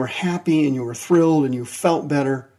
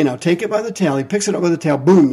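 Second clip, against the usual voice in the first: ordinary talk, nothing marked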